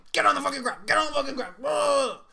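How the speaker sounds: terrorist yelling. Retold or imitated. Retelling terrorists storming in.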